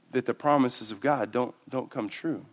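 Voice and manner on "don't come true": ordinary talk, nothing marked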